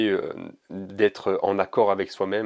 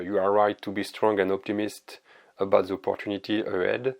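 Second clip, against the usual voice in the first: lightly French-accented English read aloud; lecture en anglais. Shown as a demonstration. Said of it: "magnifique accent anglais". (anglais lu avec un léger accent français)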